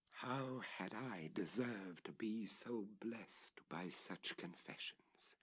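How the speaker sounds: soft, hushed rhetorical question; whispered wonder. A narrator's reading. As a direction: breathe it like a secret. Whispered question, contrast with next.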